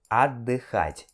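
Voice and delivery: отдыхать drawn out slowly, the T silent, the O said as A; slow teacher's model of отдыхать; slow careful model. Shown as a demonstration. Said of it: "na hora de escrever, tem que ter o T".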